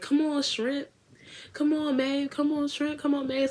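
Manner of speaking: high, coaxing call; calling them on. Retold or imitated. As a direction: call the girls up to dance. Dancers calling them forward.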